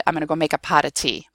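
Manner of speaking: quick, "pot of tea" run into podda-tea; fast casual speech. Shown as a demonstration. Teacher showing normal talk, "pot of tea" fast and slurred together. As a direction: rattle it off, tapping "pot of tea". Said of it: "say it in the fast, normal".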